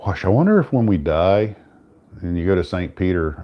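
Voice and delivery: ordinary delivery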